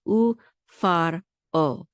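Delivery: ufar o slowly, broken syllable by syllable; separated syllables. Shown as a demonstration. Teacher showing a separate syllable, slow and broken up. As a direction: stretch out ufar and set the o apart. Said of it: "it's a separate syllable".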